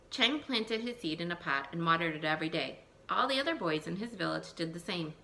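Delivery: put-on lively read-aloud for young children; storybook reading. Teacher reading a folk tale to her class, lively storytelling.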